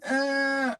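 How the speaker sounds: high, short put-on whimper; kids' fake cry on command, high whimper; mock fake cry. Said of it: "And they would".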